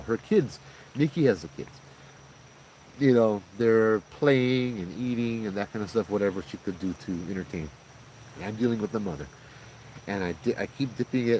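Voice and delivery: ordinary talk, nothing marked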